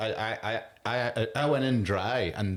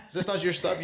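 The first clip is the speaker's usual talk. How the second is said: "your" pushed higher, as a rude hint to leave; mock hostile; 8 semitones higher than usual. How the quip sounded to the older woman, mock hostile and higher. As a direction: say "Is this not your stop?" like get out of my seat. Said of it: "it just made me sound like".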